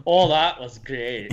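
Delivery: slow, stretched Scottish impression; Scottish accent. Shown as a demonstration. Showing off a favourite accent, slow Scottish. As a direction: play up the Scottish vowels. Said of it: "I just love doing the Scottish one".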